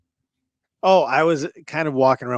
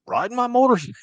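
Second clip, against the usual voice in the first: Carl's blunt answer; 12 semitones higher, 4 dB louder, faster than usual. Retold or imitated. Carl's answer quoted again.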